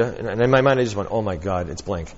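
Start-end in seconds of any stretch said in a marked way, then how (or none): 1.1-2.1 softer, inner oh my God; quiet inner alarm; mild panicked thought. Retold or imitated. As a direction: say it under your breath in dismay.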